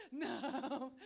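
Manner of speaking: slow, disbelieving laugh; recalling the beggar knocking again, laughing. Real, not acted. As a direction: laugh at the absurd moment.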